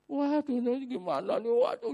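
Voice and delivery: high-pitched imitated wail; mock tearful panic. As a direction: imitate a frightened friend wailing. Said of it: "nangis-nangis loh". (menirukan tangis panik bernada tinggi)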